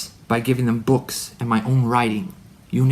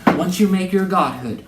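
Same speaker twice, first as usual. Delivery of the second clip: higher, mocking smarty-pants voice; 6 semitones higher, 4 dB louder than usual. Shown as a demonstration. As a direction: sneer it like a know-it-all. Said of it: "I'm gonna be a smarty pants".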